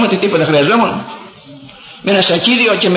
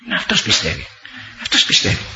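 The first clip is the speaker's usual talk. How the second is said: whispered praise; 6 dB quieter than usual. Real, not acted. Praising the believing contractor.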